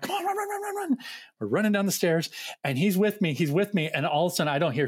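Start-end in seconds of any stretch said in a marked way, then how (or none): 0.0-1.0 high-pitched, rapid-fire hurrying; mock-panicked hurry-up in a high voice; frantic urging. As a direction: urge a kid along in a high, rushed chant. Urging kid to hurry to bathroom.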